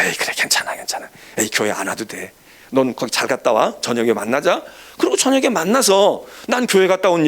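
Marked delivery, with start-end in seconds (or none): 0.0-4.6 easygoing reassurance. Retold or imitated. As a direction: let him off the hook kindly, hushed. Gently letting friend skip church.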